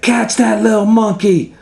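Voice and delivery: firemen shouting. Acted. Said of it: "they said".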